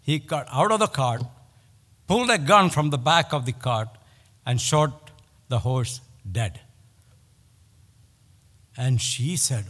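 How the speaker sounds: ordinary talk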